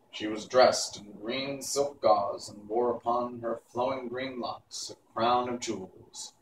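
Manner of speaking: plain reading voice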